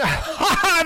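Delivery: high, loud crone's cackle; gleeful witch laugh; evil cackle. A narrator's reading. Witch laughing mid rant.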